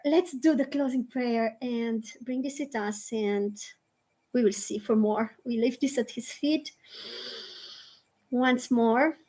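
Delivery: hushed, unhurried devotional guidance; guided prayer. Real, not acted. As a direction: settle the group gently before closing. Leads into closing prayer.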